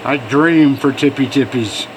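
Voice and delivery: ordinary delivery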